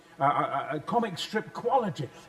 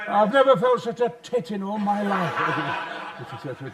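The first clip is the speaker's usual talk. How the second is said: laughing; 4 dB louder, slower than usual. Real, not acted. Laughing at himself.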